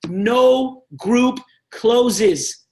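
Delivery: No group closes, each word slow and hammered; firm rule. Real, not acted. Stressing a rule to trainees.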